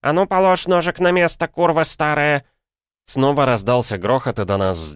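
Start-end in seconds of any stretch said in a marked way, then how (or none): 0.0-2.4 put-on high, loud angry order from an old woman; сердитый окрик. A narrator's reading. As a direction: shout down a rival granny. Old women fight over the knife. (гневный старушечий окрик)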